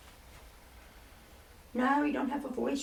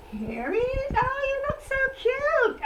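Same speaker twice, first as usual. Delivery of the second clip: cooing; 11 semitones higher than usual. Pretend. Cooing at a cute puppet.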